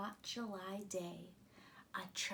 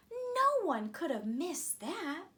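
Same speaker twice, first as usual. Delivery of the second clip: hopeful moon; 6 semitones higher, 6 dB louder than usual. A narrator's reading. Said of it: "she thought".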